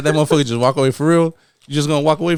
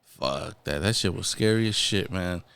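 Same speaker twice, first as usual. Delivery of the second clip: much lower and softer, almost under the breath; hushed recollection; 9 semitones lower, 8 dB quieter than usual. Real, not acted. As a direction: drop the voice remembering the fright. Recalling scary moment.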